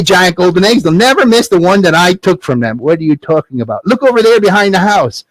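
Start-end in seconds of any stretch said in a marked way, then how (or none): 3.9-5.2 put-on excited boy character. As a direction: point it out eagerly as the son. Jack shows his mother the gold.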